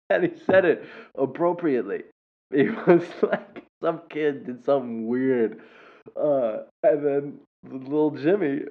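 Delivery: tickled, laugh-filled retelling; laughing while talking. Real, not acted. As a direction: struggle to tell it through giggles.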